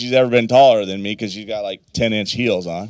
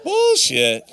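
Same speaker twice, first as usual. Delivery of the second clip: much higher, put-on indignant cry; mock outraged retort. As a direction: pretend to cry foul in a squeaky voice. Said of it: "I'm like".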